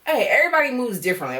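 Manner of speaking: ordinary delivery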